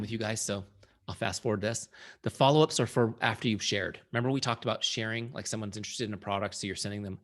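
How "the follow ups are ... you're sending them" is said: usual speaking voice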